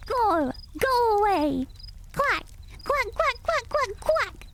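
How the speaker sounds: very high, insistent duckling quacks; angry quacking. A narrator's reading. Ducklings driving out the ugly duckling, high angry quacks. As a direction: chase him off with sharp quacks. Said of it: "All of them started to quack".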